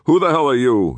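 high, loud challenge from the guard; angry demand. A narrator's reading. Angry-faced guard confronts strangers at the temple door, angry demand. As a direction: demand it forcefully, pitched right up. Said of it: "he demanded".

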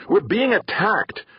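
urgent hollered alarm; hollering. A narrator's reading. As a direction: bellow the warning up to her. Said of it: "Theo hollered".

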